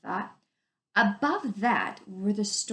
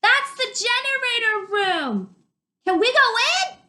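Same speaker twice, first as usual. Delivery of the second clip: high and loud, slowed, raised over the roar; shouting over the river; 14 semitones higher, 8 dB louder than usual. Acted. Dune shouting his question to Arlen over the water. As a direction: keep shouting across the noise.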